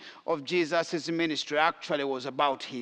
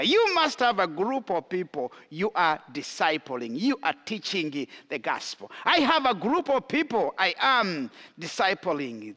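ordinary talk, nothing marked

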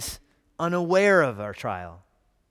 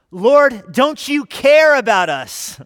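loud pleading cry, slow on care; shouting; 10 semitones higher, 15 dB louder, much slower than usual. Retold or imitated. Disciples shouting at sleeping Jesus, loud desperate plea. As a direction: yell the plea, stretching out care. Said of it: "what they're shouting".

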